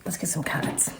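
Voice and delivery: murmured aside; quiet aside. Real, not acted. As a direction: mumble it under your breath. Aside to herself before the cards.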